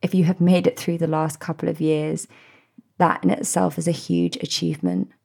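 ordinary delivery

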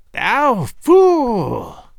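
high, loud crone's shriek; old witch scorning the king; shrieking hag. A narrator's reading. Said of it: "shrieked the old fiend".

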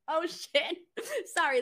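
normal talking voice

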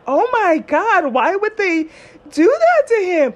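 aghast at how cruel they were; dramatic retold shock. Shocked reaction to his story.

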